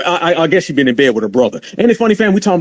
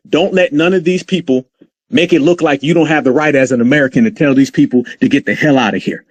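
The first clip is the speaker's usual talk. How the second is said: normal talking voice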